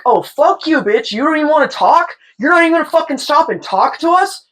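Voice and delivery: high-pitched indignant taunt; hammed-up aggrieved heckle; mock heckling. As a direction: imitate an offended catcaller demanding a reply. Hecklers acted out, causing a scene.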